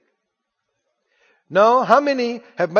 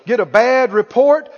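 normal talking voice

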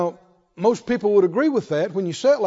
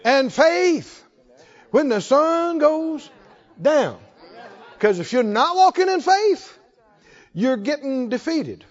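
rising, slower litany, each line ending on faith; fervent preaching; 5 semitones higher than usual. Acted. Preacher rousing the saints with a faith litany, fervent. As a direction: build the list like a revival preacher.

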